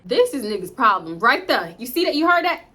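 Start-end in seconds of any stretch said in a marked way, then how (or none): 1.8-2.6 higher, louder disbelief; incredulous. Real, not acted. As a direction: call the audience in, stunned. Incredulous at audience.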